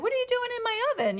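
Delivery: silly, very high, fast imitation; [name]. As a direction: squeal it playfully in a rush. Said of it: "being silly".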